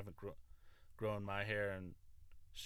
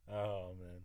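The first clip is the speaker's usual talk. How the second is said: usual speaking voice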